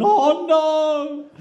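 loud, high mock wail of horror; high, drawn-out mock dismay; wife's dismay. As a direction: wail it like a mortified wife, hammed-up. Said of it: "glued to her face going".

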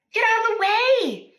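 high, loud royal yell; king shouting. Acted. King yelling at Boy to clear the way. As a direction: bark the order over the noise. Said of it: "said the king".

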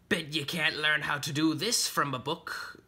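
pirate character voice, goading; taunting pirate. Acted. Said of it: "Jean Lehair said while sharpening his hook".

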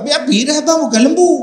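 high, loud indignant comeback; loud high retort. Acted. Ustaz acts out answering the man calling him cow, loud and high. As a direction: snap back high and loud.

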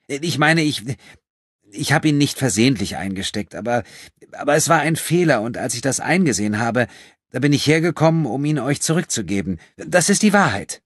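put-on high, halting, then insistent girl's voice; flustered young thief. A narrator's reading. Caught-out thief explaining to her victim, flustered and insistent. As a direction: stumble in, then build to a high, loud protest. Girl stammers out her defence.

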